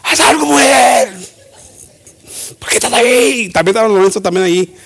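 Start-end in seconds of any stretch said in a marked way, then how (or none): 0.0-3.5 acted-out nervous husband pleading for action; panicked. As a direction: cry out urgently to a too-calm wife. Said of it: "le ven calmadita".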